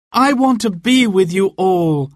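put-on high, earnest girl's voice; young woman voice. A narrator's reading. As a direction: plead to stay. Marion voiced.

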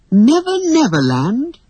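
high, rising question; amazed, high-pitched query; wondering echo. A narrator's reading. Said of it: "echoed Wendy".

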